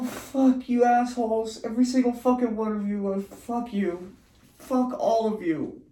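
high, loud swearing fit; furious cursing. Real, not acted. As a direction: spit out the curses at everyone. Cursing everyone while tripping.

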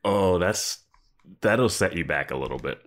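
ordinary talk

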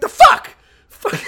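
a shocked cry mid-fall; startled yelp. Acted. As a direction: yelp as your foot slips. Bully slipping in piss.

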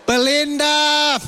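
high, drawn-out holler; long mock-desperate cry for help; panicked call. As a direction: call out to the wife in mock alarm. Retold call for wife's help.

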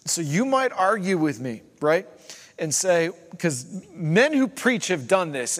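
loud, high, building sermon delivery; full-force preaching. Real, not acted. Preacher challenging those who claim God's power, impassioned preaching. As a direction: drive each example home, rising in pitch and force.

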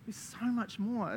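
slow, pleading dismay; dismayed God. Acted. God to complacent churchgoers, mock dismay. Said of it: "He's like".

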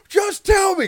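high, loud demand to be told; high-pitched impatient outburst; impatient shout. Retold or imitated. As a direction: shout it like an exasperated kid. Acting out impatient kid.

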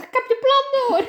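high triumphant laughing voice; Julian's gotcha. Retold or imitated. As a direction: gloat it in a high voice. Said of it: "kijkt door het raam en zegt".

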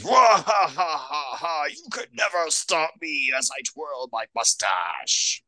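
hammy villain laugh and gloat; mustache twirling villain. Retold or imitated. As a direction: cackle like a cartoon villain. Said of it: "Baba with his".

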